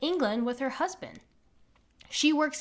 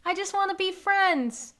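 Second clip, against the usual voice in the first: higher pleading creature voice; friendly ichthyosaur; 6 semitones higher than usual. Retold or imitated. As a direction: beg sweetly like a harmless monster. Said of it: "The ichthyosaur is going".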